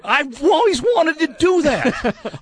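put-on high, slow old grump; curmudgeonly old man voice. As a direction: keep the cranky old man going.

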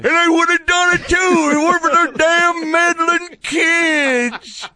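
high, slow, shouting cartoon villain; Scooby-Doo villain. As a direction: snarl it like an unmasked villain. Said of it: "great Scooby-Doo villain".

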